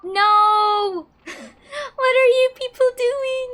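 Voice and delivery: high, loud cry of protest with a laugh; shocked outburst. Real, not acted. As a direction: yelp in disbelief, then laugh it off. Reacting to game character's joke.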